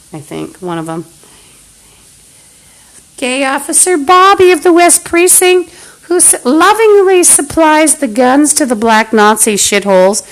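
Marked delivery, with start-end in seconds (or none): 3.2-10.2 high, loud mocking announcement of the officer; mock grand title. Ranting man mocks the police officer with a loud, high sneering title.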